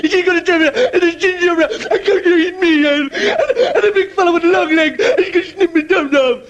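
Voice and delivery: put-on high wailing sobbing little boy; high tearful kid voice half laughing; terrified child. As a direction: blub out the monsters in panic. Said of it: "he goes".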